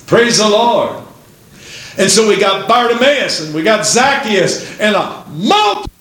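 rousing sermon reading; fervent preaching. Real, not acted. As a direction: proclaim it to the congregation. Preaches and reads scripture.

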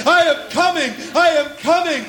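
high, fervent proclamation of coming; emotional cry. Acted. Jesus calling out to his people, fervent cry. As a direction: call it out with longing. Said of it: "That's his voice to us today".

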